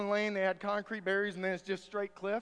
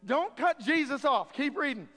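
raised, higher voice cutting in; mock scolding; 6 semitones higher, 6 dB louder than usual. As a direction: loudly shut down the objector. Scolds the imagined objector.

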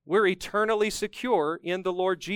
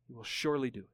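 "He will surely do it" repeated much softer and lower, "surely" gently held; hushed echo; 5 semitones lower, 10 dB quieter, much faster than usual. Real, not acted. Preacher quietly echoing the verse to the congregation, hushed. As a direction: let the line fall to a near hush. Reads the promise, then echoes it.

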